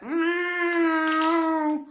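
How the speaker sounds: long, high-pitched cat whine; imitated cat sound. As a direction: draw out a high mock meow. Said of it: "Every time I do my cat sound".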